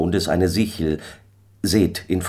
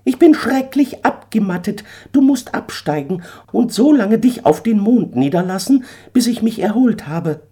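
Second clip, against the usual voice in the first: much higher, a touch tired bird voice; weary eagle; 4 dB louder than usual. Acted. Eagle tells Daniel he is tired from flying, high voice. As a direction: sigh out the long flight.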